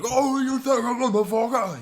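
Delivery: very high, loud British outrage; British accent. Acted. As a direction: screech it in hammed-up British. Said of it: "But in England".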